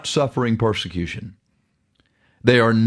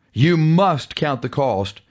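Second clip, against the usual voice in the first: normal talking voice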